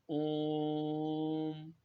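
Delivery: teacher showing a prolonged vowel. Shown as a demonstration. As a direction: draw out ओम like a call. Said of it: "प्लुत स्वर का उपयोग ... पुकारने में".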